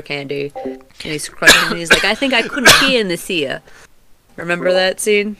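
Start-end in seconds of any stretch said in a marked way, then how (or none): 2.0-3.6 loud, raised tough-turtle voice; Raphael. Retold or imitated. Imitating a turtle annoyed by his brother's crunching, loud character voice. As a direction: put on an annoyed movie turtle's accent. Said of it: "That's my best, uh, d-, Raphael impersonation".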